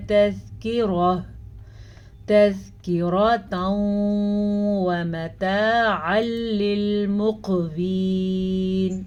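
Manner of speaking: slow melodic tajweed chant; measured Quranic recitation. Shown as a demonstration. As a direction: recite slowly, drawing out each vowel. Recitation demo.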